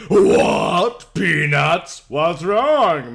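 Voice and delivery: loud rising alarm on Peanuts?; panicked, rising shout of What? and Peanuts?; alarmed yell. Acted. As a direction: shout it out in shock. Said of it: "Minna yelled".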